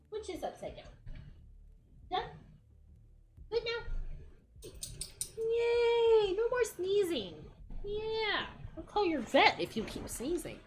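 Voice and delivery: sweet talk to a small pet. Real, not acted. Owner soothing a sneezing pet, soft baby talk.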